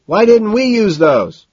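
loud, high, drawn-out yell; slow, high-pitched holler; shouted question. A narrator's reading. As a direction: shout to be heard over loud music. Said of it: "a shout to Price".

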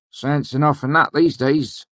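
dark remark in a high character voice; said darkly. Acted. Villager agreeing a will is sensible, said darkly. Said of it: "Shep said darkly".

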